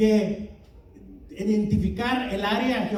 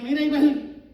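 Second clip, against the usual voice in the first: burla ligera, como quien critica a un hipócrita; "Mira, ahí va el" dicho con sorna y desdén; con desprecio; 5 semitones higher than usual. Pretend. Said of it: "de una manera mala". (crítica burlona ligera)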